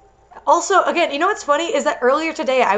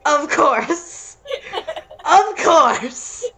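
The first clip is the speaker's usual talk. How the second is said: breaking up with laughter; laughing; 5 semitones higher, much slower than usual. Real, not acted. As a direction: laugh through the words. Laughing at stereotype.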